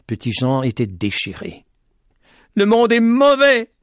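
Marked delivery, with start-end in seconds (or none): 2.5-3.7 high, loud anguished cry. A narrator's reading. Boy crying out his despair, loud anguished. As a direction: cry out in grief. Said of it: "criait-il en pleurant".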